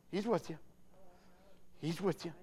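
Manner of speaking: sudden drop to very soft; gentle reassurance. Real, not acted. Reassures couple after raised call.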